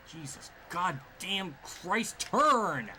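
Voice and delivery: slow curse building to a loud high turn; frustrated yell. Real, not acted. Gamer yelling at his car to turn. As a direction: drag the curse, then bark turn.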